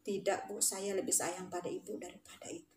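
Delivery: low, soft, sincere; tender confession. Retold or imitated. Former pupil telling teacher of love, tender confession.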